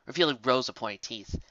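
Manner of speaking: plain reading voice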